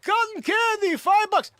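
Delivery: high-pitched mock hawker's cry; street vendor's call. Vendor hawking cotton candy to passersby, high mock call. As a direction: call it out like a caricatured street seller.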